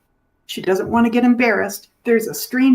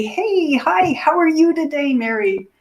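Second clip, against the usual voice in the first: high, bright greeting; cheery greeting; 6 semitones higher than usual. Retold or imitated. Caregiver walking in and greeting a client, cheerfully. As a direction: sing it out cheerfully. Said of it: "and I'd say".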